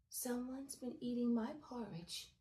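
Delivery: plain reading voice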